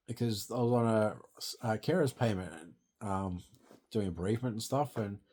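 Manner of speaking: normal talking voice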